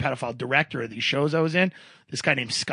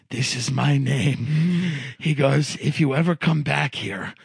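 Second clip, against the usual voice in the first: slow, lowered, resigned apology; defeated salesman; 4 semitones lower than usual. Retold or imitated. Salesman handing his written name to lost customer, defeated. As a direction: say it low and slow, beaten.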